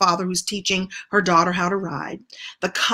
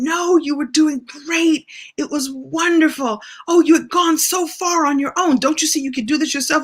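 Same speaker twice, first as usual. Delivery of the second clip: higher, soothing encouragement; reassuring father; 7 semitones higher, 3 dB louder than usual. Retold or imitated. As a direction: comfort a crying child. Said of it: "picking me up and saying".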